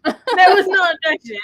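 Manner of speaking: loud laughing line. Real, not acted. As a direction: laugh through it. Laughing repeat.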